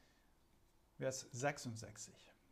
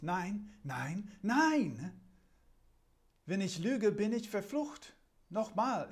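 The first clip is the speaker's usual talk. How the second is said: higher, louder frightened protest; panicked denial; 5 semitones higher, 6 dB louder, slower than usual. Acted. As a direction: deny it desperately, caught and scared. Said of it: "Von Angst gepackt verteidigt sich Petrus".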